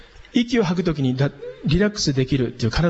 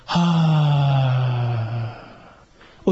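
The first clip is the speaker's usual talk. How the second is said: long, even "haa" on one steady low note; relaxed held tone; 4 semitones lower than usual. Shown as a demonstration. Teacher showing diaphragm breath to students, relaxed. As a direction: let the voice ride the out-breath. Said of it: "せーの".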